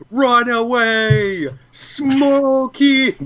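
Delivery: long drawn-out high holler; yell. Acted. Player quoting the escape catchphrase for a friend, yelled high and long. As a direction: stretch each word into a big comic yell. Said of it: "you also need to, uh, yell".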